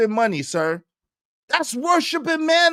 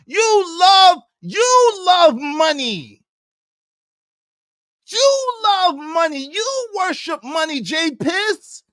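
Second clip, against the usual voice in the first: taunting; 6 semitones higher, slower than usual. Real, not acted.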